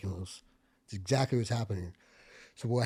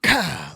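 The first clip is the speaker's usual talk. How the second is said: mimicked bullet crack; 3 semitones higher, 11 dB louder than usual. Imitating real bullet crack.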